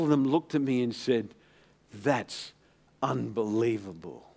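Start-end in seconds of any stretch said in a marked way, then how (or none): 1.9-4.3 quiet amazement. Retold or imitated. Quotes the amazed senior engineers.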